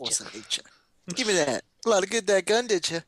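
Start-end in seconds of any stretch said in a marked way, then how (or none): 1.1-3.0 high mocking taunt; taunting monster. Playing monster grabbing.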